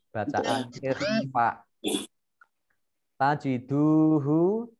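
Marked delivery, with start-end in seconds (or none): 3.1-4.7 slow "Tajiduhu" with a long "duu"; "Tajiduhu", measured and drawn out; lengthened two beats. Shown as a demonstration. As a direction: recite "Tajiduhu", stretching the u. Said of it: "panjang dua harokat".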